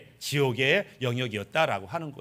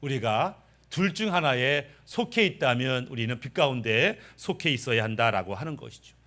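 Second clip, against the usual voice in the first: ordinary talk, nothing marked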